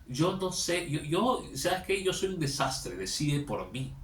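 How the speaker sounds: high, loud pleading, easing at the end; exasperated, hammed-up surrender; mock desperation. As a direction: imitate a helpless citizen begging to be led. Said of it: "Esa desesperación".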